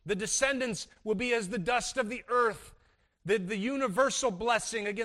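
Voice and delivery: usual speaking voice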